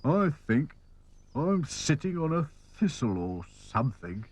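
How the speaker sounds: sulky, put-upon character; glum. A narrator's reading. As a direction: complain dolefully about discomfort. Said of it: "said Sad Sack glumly".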